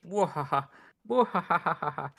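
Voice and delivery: loud villain cackle, last one drawn out; hammy mad scientist laugh; evil laugh. Acted. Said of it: "kept it the mad scientist act".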